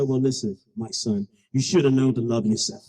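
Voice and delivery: very low, fatherly God voice. Acted. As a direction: answer from heaven in a deep voice. God answers the boy.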